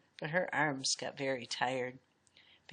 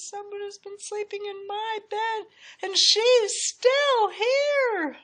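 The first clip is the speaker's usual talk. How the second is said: put-on very high baby bear, building to a loud drawn-out wail; crying wee little voice; 6 dB louder than usual. A narrator's reading. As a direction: start as a squeaky cub, then wail slowly. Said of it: "cried Baby Bear in his wee little voice".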